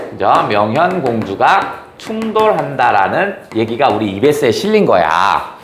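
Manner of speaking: usual speaking voice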